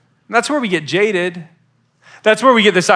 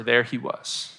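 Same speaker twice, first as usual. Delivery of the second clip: hushed; 5 semitones lower, 8 dB quieter, slower than usual. Acted. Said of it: "in a whisper".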